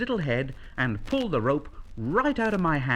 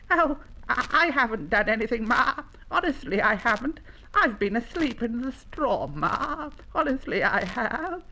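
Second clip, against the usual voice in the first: put-on high, quick little child-animal voice; anxious young llama; 8 semitones higher than usual. Young llama protests innocence to his Ma, anxious.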